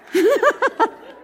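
a high, bright burst of laughter; delighted laugh. Real, not acted. Preacher laughing at the bracelet's sign, high and loud. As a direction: laugh in happy astonishment.

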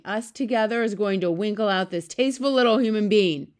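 loud giant's gloating announcement; shouted threat. A narrator's reading. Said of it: "he shouted".

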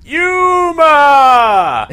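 long, high, loud call; shouting. Retold or imitated. Imitating the movie's shouted cat name.

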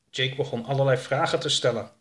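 ordinary delivery

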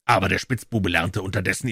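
ordinary delivery

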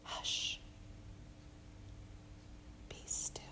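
barely audible soft whisper. Acted. Jesus calming the wind and waves, soft whisper. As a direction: hush the storm gently in a whisper. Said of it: "attention-grabbing whisper".

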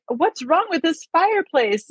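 mock bafflement at the boarded fireplace; puzzled Arthur. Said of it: "he's like".

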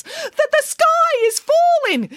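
very high, loud alarm; high-pitched storybook urgency; urgent cry. A narrator's reading. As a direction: raise the alarm in a squeaky chicken voice. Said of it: "urgently told the guards".

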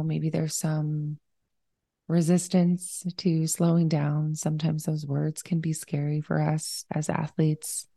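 unhurried, even-toned breathing cues; guided meditation. Real, not acted. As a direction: guide them into slowing down, leaving room to breathe.

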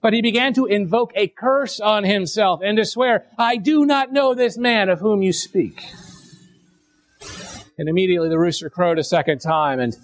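measured, solemn Bible reading, slowing into the weeping; scripture reading. Acted. Pastor reads the Gospel to his congregation, solemn reading. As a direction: read the passage like a pulpit lesson.

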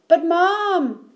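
put-on high, loud child's protest; alarmed gasp. A narrator's reading. Eddie calls after Mom as she leaves, gasping. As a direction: cry out in sudden alarm. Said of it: "gasped Eddie".

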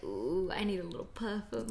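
breathy whispered aside; whispering. Retold or imitated. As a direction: whisper it to yourself. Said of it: "I was like".

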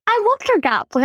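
high-pitched, delighted fan enthusiasm; excited gushing. Real, not acted. Co-host gushing over a film character's outfit, excited. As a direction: gush about it, pitch jumping up.

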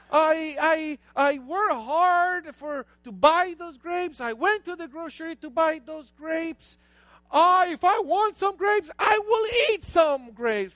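loud, high, emphatic scolding; hammed-up outrage, leaning on the words; mock indignant father. Father asserts his right to grapes, loud mock indignation.

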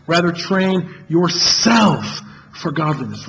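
yourself drawn out and stressed, slow recital; slow right down and press on yourself. Shown as a demonstration. Preacher demonstrating the memorization drill, stressing yourself. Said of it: "each time emphasizing a different word".

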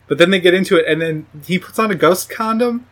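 ordinary talk

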